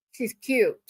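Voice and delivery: normal talking voice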